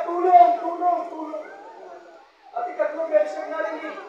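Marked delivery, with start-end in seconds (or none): 0.0-1.5 cry for help. Retold or imitated. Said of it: "nag-pray pa rin siya".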